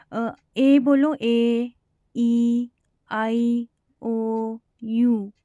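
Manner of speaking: ए, ई, आई, ओ, यू held long and clear; vowel sounds drilled. Shown as a demonstration. Teacher having students pronounce vowels, slow and clear. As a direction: draw each vowel out slowly. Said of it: "आप खुद भी pronounce करो".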